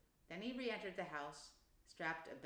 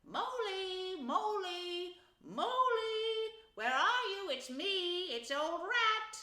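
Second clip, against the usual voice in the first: high, loud searching holler; cheery calling out; 12 semitones higher, 8 dB louder, much slower than usual. A narrator's reading. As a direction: call cheerily through the woods for a friend. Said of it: "all the time calling out cheerily".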